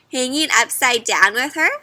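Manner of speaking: plain reading voice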